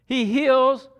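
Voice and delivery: fervent Sunday preaching. Real, not acted. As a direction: lift the room with good news. Preaching full force.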